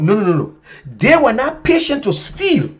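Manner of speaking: normal talking voice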